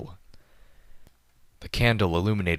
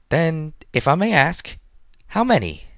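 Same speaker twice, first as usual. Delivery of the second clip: startled, high and louder question; taken aback; 11 semitones higher, 6 dB louder than usual. A narrator's reading. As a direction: caught off guard, voice jumping up. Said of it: "clearly taken aback".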